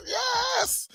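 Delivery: a high, joyful yelp of yes; excited shout. Real, not acted. Vlogger cheering her nearly reached follower goal, high excited shout.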